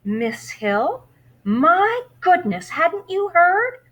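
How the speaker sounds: high, loud, slow astonished gasp of a librarian; surprised librarian. Acted. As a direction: she can't believe he hasn't heard the news. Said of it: "The librarian looked surprised".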